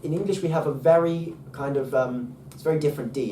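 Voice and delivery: normal talking voice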